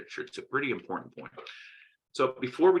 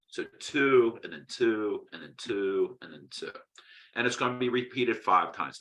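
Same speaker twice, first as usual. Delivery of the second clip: ordinary talk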